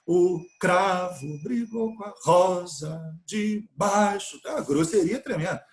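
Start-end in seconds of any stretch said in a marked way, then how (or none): 0.1-4.4 plodding, slow, every beat of "O cravo brigou com a rosa de baixo" thumped; heavy beat-by-beat reading. Shown as a demonstration. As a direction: hammer out "cravo", "rosa", "baixo" like a clumsy reader. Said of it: "ninguém lê assim".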